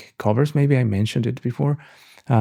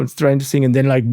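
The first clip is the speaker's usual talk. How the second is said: normal talking voice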